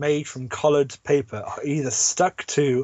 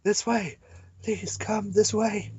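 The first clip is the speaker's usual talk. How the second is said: put-on higher, slow girl calling; lightly voiced girl repeating; 6 semitones higher, 3 dB quieter, slower than usual. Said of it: "Another voice quickly repeats".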